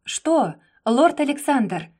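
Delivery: high, loud, challenging; defiant. A narrator's reading. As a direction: throw it back at him. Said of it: "вызывающе вскинула подбородок". (вызывающе)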